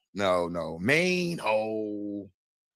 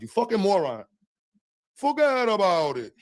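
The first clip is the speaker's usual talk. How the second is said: Tony Two Times; 5 semitones higher than usual. Pretend. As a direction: insult him like a mob guy. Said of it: "Tony two times".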